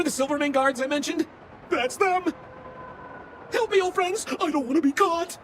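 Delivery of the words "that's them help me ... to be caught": high, panicky cry for help; mock panic. Fleeing guards, calling friends for help in high panic. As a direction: yelp like you're about to be caught.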